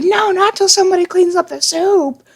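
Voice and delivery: very high-pitched whining outburst; hammed-up high wailing protest; mock tantrum. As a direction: imitate a little brother in a fit of rage. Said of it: "He's like".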